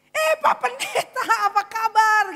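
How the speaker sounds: very high, loud hello; exaggerated shouted how-are-you; booming greeting. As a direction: call out a big delighted welcome. Acted greeting on meeting.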